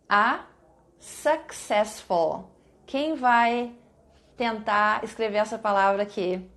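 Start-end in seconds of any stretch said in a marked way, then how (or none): 0.1-2.5 drawn-out successful, syllable by syllable; slow, clear dictation. Shown as a demonstration. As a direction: stretch successful slowly for spelling. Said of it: "see if you know how to spell this word".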